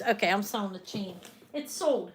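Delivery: ordinary talk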